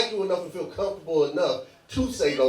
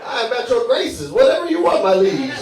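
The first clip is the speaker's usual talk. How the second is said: mock servant; 5 semitones higher, 6 dB louder than usual.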